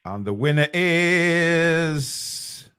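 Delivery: very slow drawn-out reveal; dramatic announcement. Acted. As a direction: stretch it out for suspense. Drawing the prize winner.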